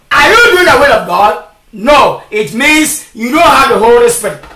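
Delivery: usual speaking voice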